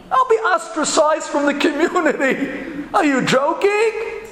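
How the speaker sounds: high, aghast protest; mock dismay. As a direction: act scandalized, pitch up and stretch the question. Partner aghast at social shame.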